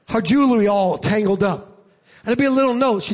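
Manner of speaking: normal talking voice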